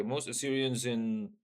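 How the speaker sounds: ordinary talk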